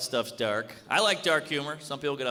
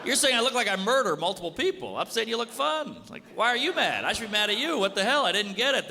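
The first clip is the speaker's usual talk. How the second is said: high, incredulous comic rant; indignant protest; 6 semitones higher than usual. Retold or imitated. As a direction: argue back as if wrongly accused. Acted argument with his offended date.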